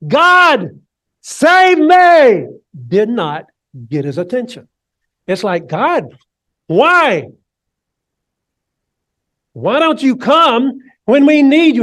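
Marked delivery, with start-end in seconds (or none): 0.1-2.5 desperate cry to God, louder and higher; shouting. Acted. David shouting to God for rescue, desperate cry. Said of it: "His shouts". 5.7-11.8 anguished plea. Acted. Voicing an anguished prayer.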